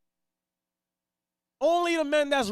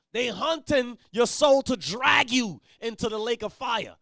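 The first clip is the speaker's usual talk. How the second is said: ordinary talk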